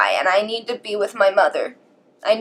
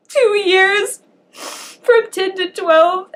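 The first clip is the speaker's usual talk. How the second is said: tearful, voice high and breaking; real crying; 10 semitones higher, 5 dB louder than usual. Real, not acted. Telling viewers no one in the house hugged her, crying. Recounting years without affection.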